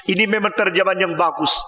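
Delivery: usual speaking voice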